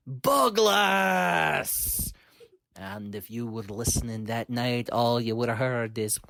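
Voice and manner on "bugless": drawn-out war cry of his own name; battle scream. Acted. Bug hero screaming his name into the night, war cry. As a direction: yell it into the night. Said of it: "he screamed into the night".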